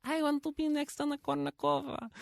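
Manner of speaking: put-on very high girlish voice, Russian accent. Imitating young Russian girls inspired by tennis, high Russian accent. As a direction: play a little girl with a Russian accent. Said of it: "except in a Russian accent".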